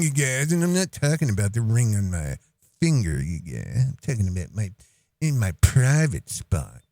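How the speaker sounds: lightly put-on cult leader voice; mocking impersonation. Cult leader boasts that they all come back, mock impersonation. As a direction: imitate a self-important leader, dropping low.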